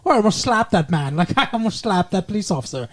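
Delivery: bad Hungarian diva accent with a laugh; hint of a hammy Hungarian accent, laughing through it; mock Zsa Zsa accent. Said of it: "she'd be like".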